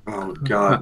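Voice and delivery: ordinary talk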